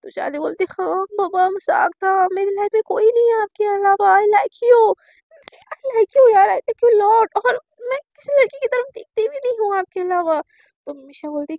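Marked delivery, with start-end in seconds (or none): 0.7-10.4 higher and much louder, insisting I like you; loud confession. Retold or imitated. Girl confessing her love over the phone, loud and insistent. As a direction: blurt out your feelings.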